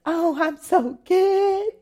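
high, drawn-out phony brightness; mock a polite lie in a high, slow voice; fake cheerfulness. Churchgoer hiding that they are dying, fake cheery answer.

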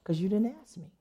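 very soft, matter-of-fact reply; quiet voice of God. Retold or imitated. God answering the woman who wanted healing, quiet correction. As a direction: imitate God gently putting her straight, hushed. Does God really talk like that?.

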